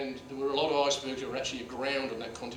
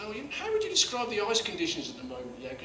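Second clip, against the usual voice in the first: sweetly formal, a little higher; overly polite; 7 semitones higher than usual. Retold or imitated. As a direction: ask it with mock politeness. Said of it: "sort of said".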